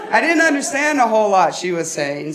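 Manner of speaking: usual speaking voice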